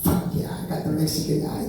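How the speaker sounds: quiet, breathy aside of relief; whispered glee. Retold or imitated. Comic whispering relief at getting the Mexican examiner. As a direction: whisper it like a secret win. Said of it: "And I'm like".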